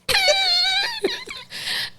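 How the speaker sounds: a high giggle bursting out; high squealing laugh. Real, not acted. Teller laughing at her kids, high squeal. Reaction to kids' answer.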